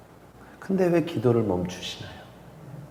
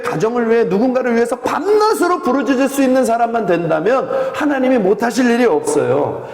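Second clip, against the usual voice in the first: loud, high, impassioned sermon voice; full-force Korean pulpit preaching; fervent preaching; 11 semitones higher, 6 dB louder than usual. Acted. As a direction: preach it out to the whole church.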